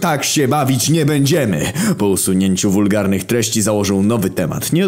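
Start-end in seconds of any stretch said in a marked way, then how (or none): 0.0-1.7 angry scolding. Acted. Adam scolding the troll.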